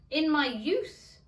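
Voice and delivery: put-on slow, loud old-man voice; lightly voiced old father. A narrator's reading. Old father answering his son, slow and loud. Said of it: "said his father".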